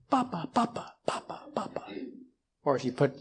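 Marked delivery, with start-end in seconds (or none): 0.1-1.8 hushed, whispery imitation of babbling "Papa"; babbling baby. Six-month-old reaching out for a parent, imitated soft babble. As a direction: softly repeat "Papa" like an infant. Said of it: "he would reach out for me and just say".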